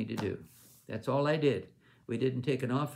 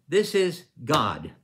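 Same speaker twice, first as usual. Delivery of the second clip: louder and slow, weighty; solemn declaration; 2 semitones higher, 9 dB louder, slower than usual. Retold or imitated. Brother Hagin rising at the luncheon, slow loud solemn declaration. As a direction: proclaim it slowly with full weight.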